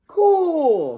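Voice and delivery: very high, loud cool; excited kid. A narrator's reading. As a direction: blurt it out thrilled.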